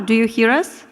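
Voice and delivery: ordinary delivery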